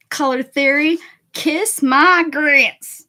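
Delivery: a slow, loud Abilene Texas drawl on "kiss my grits"; thick Texas accent. Acted. Streamer showing chat her Abilene Texas accent. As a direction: lay the West Texas accent on thick and stretch it out. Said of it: "it was like the Abilene, Texas".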